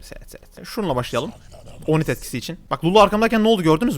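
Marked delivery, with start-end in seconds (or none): 0.0-0.7 hushed, breathy seyret seyret; whisper. Real, not acted. Streamer telling viewers to watch the play, whispered. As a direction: whisper it like a secret trick.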